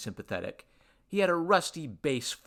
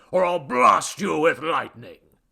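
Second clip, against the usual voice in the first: loud, slow, higher menace; blustering grumpy god; grumbling threat; 5 semitones higher, 10 dB louder than usual. A narrator's reading. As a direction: threaten grandly, stretching the words. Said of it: "grumbled the god".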